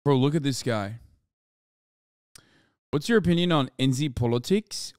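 ordinary delivery

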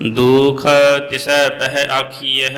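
slow, measured Gurbani chant; scripture recitation. Acted. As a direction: draw out the line endings, pause between phrases.

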